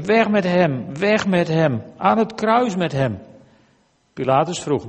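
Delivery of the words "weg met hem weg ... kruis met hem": higher-pitched, moderately raised reading cry; chant-like repeated demand from a crowd, read aloud; crowd shouting. A narrator's reading. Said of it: "Meteen schreeuwden ze". (ingehouden voorgelezen geschreeuw van een menigte)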